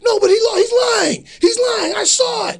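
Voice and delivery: high, loud frantic protest; screaming. Retold or imitated. Angry fan to onlookers as wrestler leaves, screaming. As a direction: yell your accusation to the whole room. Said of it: "this dude screaming".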